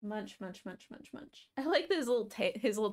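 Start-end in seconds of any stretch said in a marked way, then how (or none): 0.0-0.6 murmured munch, munch, much softer; quiet munching narration. Real, not acted. Streamer voicing the cat chewing treats, soft murmur. 0.7-1.5 whispered munching. Real, not acted. Streamer voicing the cat chewing treats, fading to a whisper.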